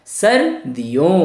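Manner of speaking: सर्दियों slow, sar-di-yon, ending on a rise; repeat-after-me. Shown as a demonstration. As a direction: lead learners slowly through सर्दियों. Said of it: "एक बार फिर से बोलिए मेरे साथ". (साथ दोहराने के लिए सर्दियों धीरे बोलें)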